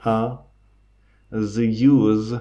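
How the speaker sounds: brief mock German accent. Shown as a demonstration. Podcast host demonstrating the film's dialect, German accent. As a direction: put on a touch of German accent. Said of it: "Prata svenska med tysk".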